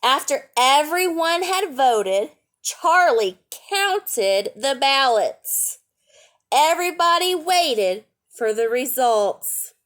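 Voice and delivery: bright read-aloud storytelling voice; lively picture-book reading; story narration. A narrator's reading.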